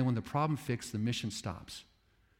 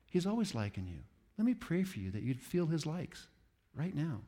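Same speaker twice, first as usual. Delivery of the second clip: normal talking voice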